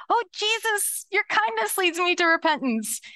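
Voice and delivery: crying out, overwhelmed by His kindness; heartfelt repentant cry. Retold or imitated.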